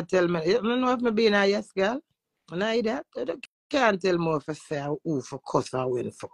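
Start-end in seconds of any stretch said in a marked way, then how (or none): none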